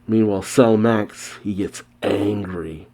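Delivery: usual speaking voice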